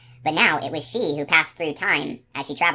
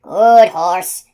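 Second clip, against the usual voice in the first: loud, winded praise; out of breath from chasing; panting; 2 semitones higher, 11 dB louder than usual. A narrator's reading. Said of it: "the fat man panted".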